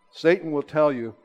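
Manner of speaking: usual speaking voice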